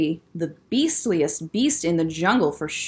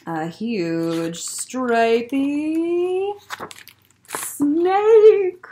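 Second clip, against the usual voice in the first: drawn-out suspense reveal; 5 semitones higher, much slower than usual. A narrator's reading. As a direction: build slow tension, then pop the last word. Drawn-out suspense then burst.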